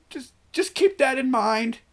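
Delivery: high-pitched, loud teasing warning; squeaky high warning. Pretend. Playful repeated warning, first take.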